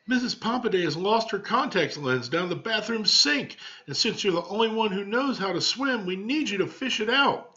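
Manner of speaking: put-on high, eager child's voice; excited little girl. Cousin tells Marvin he must swim for the lens, high and eager. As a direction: gush it out like a thrilled little girl.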